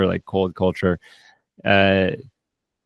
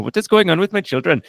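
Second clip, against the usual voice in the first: put-on lightly Indian-accented worried mother, higher; a playful Indian mother's accent, pitched up; mock Indian accent; 9 semitones higher, 3 dB louder than usual. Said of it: "I have fun with accents".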